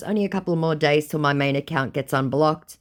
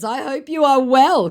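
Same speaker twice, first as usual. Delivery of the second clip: imitating the scammer; 12 semitones higher, 6 dB louder than usual. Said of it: "said my scammer".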